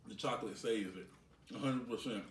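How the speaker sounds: talking with food in mouth; chewing while talking. Real, not acted. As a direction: mouth partly full of candy. Seen 0: Man in grey holds bar, bites and chews while talking.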